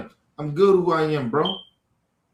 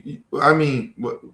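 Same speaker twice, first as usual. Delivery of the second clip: ordinary delivery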